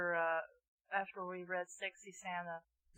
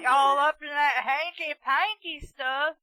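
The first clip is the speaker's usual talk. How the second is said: put-on hillbilly accent; 5 semitones higher, 9 dB louder than usual. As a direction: bellow it like a country yokel. Joins the hillbilly bit.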